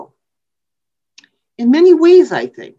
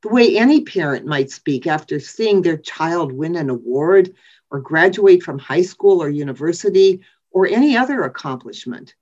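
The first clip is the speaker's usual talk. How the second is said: ordinary talk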